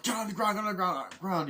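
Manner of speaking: high, hurried mock command; re-enacted frantic order; acted soldier's order. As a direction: imitate soldiers yelling orders.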